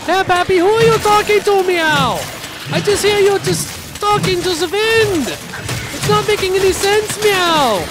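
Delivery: high, complaining cat character voice; puzzled cat sidekick. Acted. Cat asking Pappy who he's talking to, puzzled. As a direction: pester him in a high cat voice.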